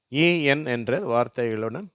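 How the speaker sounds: ordinary talk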